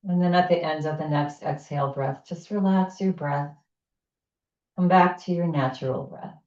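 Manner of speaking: pace the listener through each inhale and exhale; guided breathing. Acted. Leads a breathing exercise.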